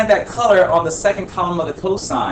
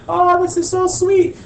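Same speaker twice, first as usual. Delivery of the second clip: much higher mock-thrilled student voice; excited student. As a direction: gush like an impressed kid in class. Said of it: "You guys would have been like".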